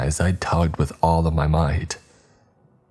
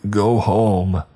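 slow warning; 4 semitones higher, slower than usual. Acted. Husband warning his wife.

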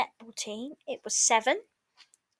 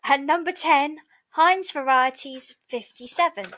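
raised, sing-song bingo calling; bingo caller; 3 semitones higher, 5 dB louder than usual. Acted. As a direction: call it out like a bingo hall caller. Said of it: "bingo calls".

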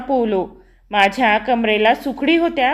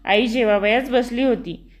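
ordinary delivery

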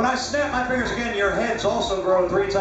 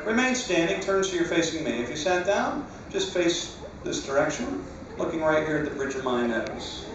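hypnotized dreamy voice; 5 semitones lower than usual. Acted. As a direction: lull them into deep sleep. Hypnotist putting people to sleep.

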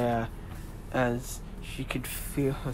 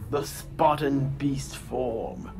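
a Spartan god mocking his foe; booming god voice; 2 semitones higher than usual. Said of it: "this booming voice".

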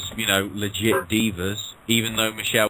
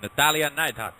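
names said in a heavy Russian accent; 3 semitones higher than usual. Real, not acted. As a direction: roll it out like a Russian announcer. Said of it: "Why did I say that in a Russian accent?".

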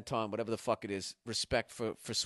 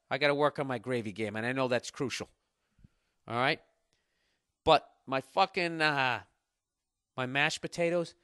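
ordinary talk, nothing marked

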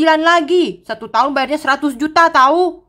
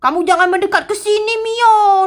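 much higher, urgent fearful warning; frightened warning; 9 semitones higher than usual. Acted. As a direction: keep Mio away from the giant. Warning Mio away from giant.

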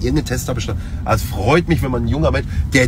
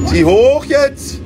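high, slow urgent shout; 13 semitones higher, 5 dB louder, much slower than usual. Real, not acted. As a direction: yell the command up high. Sim landing, urging pilot on.

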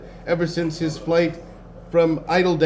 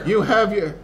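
put-on higher-pitched elderly character; old wizard; 5 semitones higher than usual. A narrator's reading. Old wizard addresses the princess, in raised pitch. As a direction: begin a kindly old man's greeting.

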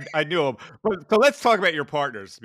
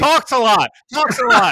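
louder, higher mock jab; playful teasing jab; 8 semitones higher, 8 dB louder than usual. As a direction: needle him about it. Teasing about partner talking.

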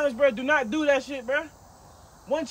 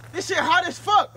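raised, pained voice; mouth burning from spicy food; 7 semitones higher, 6 dB louder than usual. Real, not acted. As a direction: cry out from the burn.

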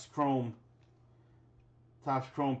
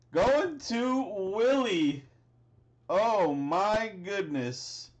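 excited; 6 semitones higher, 3 dB louder, much slower than usual. Real, not acted. Giving the hit to a buyer.

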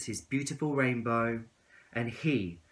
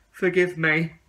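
louder, higher plea; begging; 6 semitones higher, 9 dB louder than usual. Acted. Tadpole begging the caterpillar, louder and higher. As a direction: beg him to forgive you. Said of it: "begged the tadpole".

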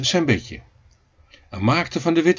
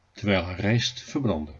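ordinary delivery

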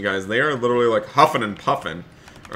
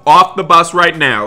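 stern command; 4 semitones higher, 8 dB louder than usual. Pretend. Ordering five-year-old off bus.

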